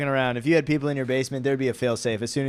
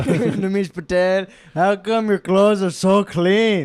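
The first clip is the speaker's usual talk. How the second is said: high, slow, louder mock Indian accent; drawn-out accented challenge to the comic; audience member impression; 5 semitones higher, 6 dB louder, slower than usual. Audience member questioning the comic's clean clothes, slow put-on accent. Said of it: "And then he goes".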